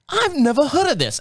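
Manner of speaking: high-pitched mock British bartender; Scottish-turned-British accent. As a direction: imitate a Scottish woman, sounding British. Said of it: "it's gonna sound British, I know".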